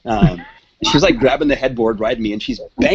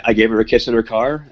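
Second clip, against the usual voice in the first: ordinary talk, nothing marked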